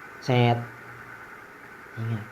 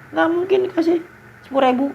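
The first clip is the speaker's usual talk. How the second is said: mock disbelief; faster than usual. Incredulous at a small sum.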